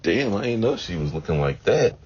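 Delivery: surprised realization. Retold or imitated. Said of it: "I was like".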